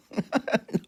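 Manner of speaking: high giggle. Real, not acted. Laughing at own slip.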